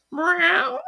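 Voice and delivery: very high laughing meow; cat meow. Retold or imitated. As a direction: squeak it like a little kitten. Imitating cat sound.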